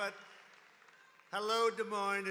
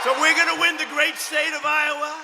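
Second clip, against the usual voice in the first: shouting; 5 semitones higher, 12 dB louder than usual. Real, not acted. Rally crowd shout.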